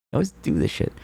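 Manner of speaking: high, whiny put-on voice; whiny high mock complaint. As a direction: pitch it up like a sulky kid.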